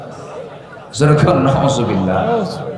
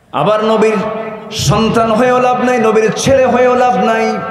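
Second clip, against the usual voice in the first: raised, ringing sermon delivery; preaching; 7 semitones higher than usual. Acted. Preacher rousing the mahfil crowd, full-voiced preaching.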